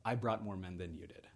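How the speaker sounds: quiet, knowing payoff line; aside to a friend. Acted. Leonidas to his friend Daxos, quietly making his point. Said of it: "he turns around to the, to his, to his friend Daxos".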